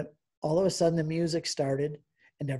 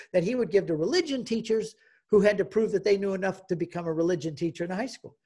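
ordinary talk, nothing marked

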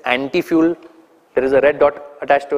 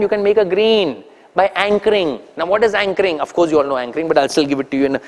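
ordinary talk

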